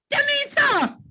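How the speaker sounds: name shouted out high in delight; excited call. Real, not acted. Teacher spotting a student's name, excited high call. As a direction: sing out the name happily.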